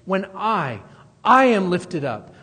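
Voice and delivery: a loud, forceful "I" after a short break; stress on I. Retold or imitated. Preacher showing how Jesus emphasizes that it's him, stressed "I". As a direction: land heavily on the repeated "I". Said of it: "He's even emphasizing that it's him".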